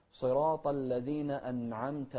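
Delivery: slow, loud صراط with heavy s and أنعمت with still n; correct recitation. Shown as a demonstration. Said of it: "الصاد نوضحها". (ترتيل صراط و أنعمت بوضوح)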